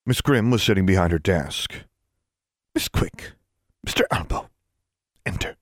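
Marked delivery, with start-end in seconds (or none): 2.7-5.6 cool, unvoiced summons; chilly and restrained, like clinking ice cubes; icy. A narrator's reading. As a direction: call them in coldly, with little voice. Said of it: "Her voice sounded like ice cubes".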